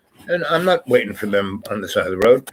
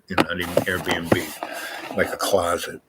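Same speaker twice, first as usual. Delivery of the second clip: snide whispered aside; 4 semitones lower, 5 dB quieter than usual. Real, not acted. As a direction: whisper it like a dig. Snide aside about her lodging.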